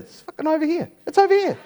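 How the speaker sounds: high triumphant cry; excited. Acted. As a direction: shout it out with glee. Excited discovery.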